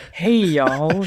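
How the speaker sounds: smooth lounge-singer greeting. Retold or imitated. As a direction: croon a laid-back hello.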